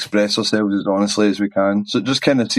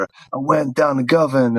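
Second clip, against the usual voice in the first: slow, higher, put-on American-style accent; mock transatlantic accent; 5 semitones higher, slower than usual. Joking about a Glasgow singer faking a transatlantic accent, mock American twang. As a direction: say a Glasgow place name in a fake mid-Atlantic singer's twang. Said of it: "as opposed to, like, a transatlantic accent".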